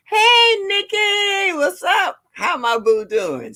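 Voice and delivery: high, loud, sung-out hello; excited greeting. Real, not acted. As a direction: call out to a friend joining in. Greeting a viewer by name.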